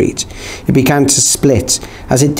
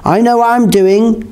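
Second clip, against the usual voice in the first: higher-pitched, exasperated retort; eye-rolling impatience; 9 semitones higher than usual. A narrator's reading. Norva rejects being told how, eye-rolling impatience. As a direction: say it with an eye-roll. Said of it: "rolling her eyes".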